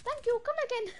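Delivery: high, chirpy cashier voice. Retold or imitated. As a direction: chirp it like a shop clerk. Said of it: "I said to him".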